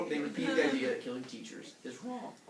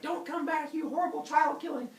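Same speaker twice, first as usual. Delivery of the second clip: high, indignant holler; mock angry banishment; 14 semitones higher, 4 dB louder than usual. Villagers driving Heracles away, high outraged shout. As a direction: yell after a fleeing wrongdoer, comically high.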